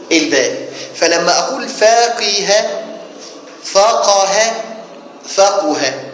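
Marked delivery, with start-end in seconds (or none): 1.7-2.7 فاقه said slowly as "faqiha", with i after the qaf; middle vowel i. Shown as a demonstration. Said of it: "مكسور العين". 3.6-4.5 فقه said "faqaha", raised pitch, with a after the qaf; middle vowel a. Shown as a demonstration. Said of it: "مفتوح العين". 5.3-6.0 فقه said "faquha", with u after the qaf; middle vowel u. Shown as a demonstration. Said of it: "مضموم العين".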